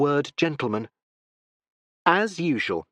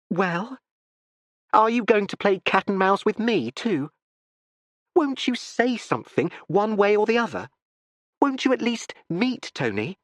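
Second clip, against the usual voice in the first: higher, urgent pleading questions; breathless young woman; 9 semitones higher than usual. A narrator's reading. Daughter pleading with her father, breathless. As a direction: press him, breathless and impatient. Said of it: "Constance spoke breathlessly".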